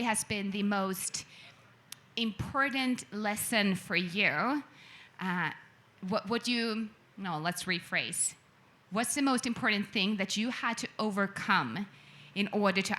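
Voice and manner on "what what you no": ordinary delivery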